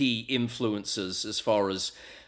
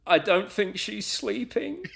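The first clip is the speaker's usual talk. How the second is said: high, slow soap-opera line with rising end; imitated soap granddaughter; 9 semitones higher than usual. Granddaughter on Neighbours finds Helen dead, imitated in a high, slow voice. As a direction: mimic the granddaughter, pitched well up, drawn out. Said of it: "where the granddaughter goes".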